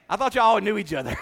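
loud laugh through the words. Real, not acted. Preacher laughing at a silent congregation. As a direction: giggle it out.

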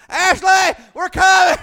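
very high, loud shout; frantic loud parent yell; yelling across a cornfield. Retold or imitated. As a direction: call out over the corn to a lost child. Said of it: "We're yelling across that cornfield".

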